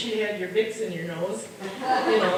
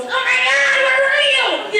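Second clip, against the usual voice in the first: much higher, loud shocked cry; husband recoils from the smell, much higher shocked cry; 8 dB louder than usual. Retold or imitated. Husband sees her come home, shrieking in high shock. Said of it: "He goes".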